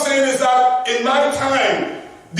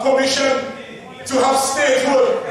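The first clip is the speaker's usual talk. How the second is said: normal talking voice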